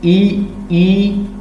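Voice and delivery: long, high ई with spread lips; teacher modelling the vowel ई; vowel ee demo. Shown as a demonstration. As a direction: stretch ई out with lips pulled wide. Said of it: "आप कहें ई".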